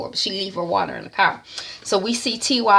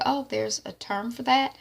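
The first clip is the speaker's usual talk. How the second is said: quiet bemused aside; 3 semitones higher, 7 dB quieter than usual. Retold or imitated. Soft puzzled aside.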